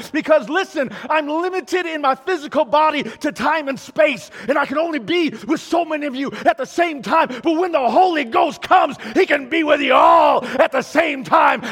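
rising, shouted preacher's build; full-force preaching. Acted. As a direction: drive it home to the congregation.

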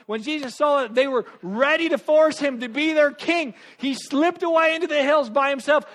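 loud, high, driving sermon delivery; preaching at full force. Acted. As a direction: preach it hard and fast to the crowd.

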